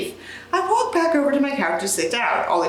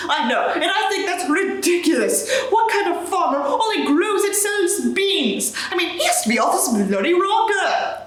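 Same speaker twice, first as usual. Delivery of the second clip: British Sanders; 6 semitones higher than usual. Acted. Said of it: "I can't do a British accent".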